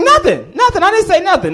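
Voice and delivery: very high, loud mock panic; panicked denial. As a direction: squeal your innocence like a caught pupil. Caught pupil hastily denies it.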